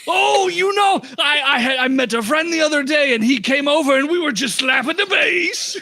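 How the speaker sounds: high, laughing put-on Jamaican accent; goofy Jamaican shopkeeper bragging. Shopkeeper answering a player's joke in a Jamaican accent. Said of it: "Jamaican accent".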